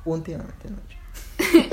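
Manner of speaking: slow, pointed repeat of "Últimamente no he hecho"; slow deliberate repeat. Real, not acted. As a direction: say "Últimamente no he hecho" slowly and defiantly. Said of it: "Shh, eso no se dice".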